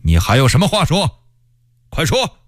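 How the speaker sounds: understated commanding shout, rising at the end; stern demand. A narrator's reading. Rebel king challenging the condemned stranger, sternly. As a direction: demand an answer sharply. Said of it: "喝道".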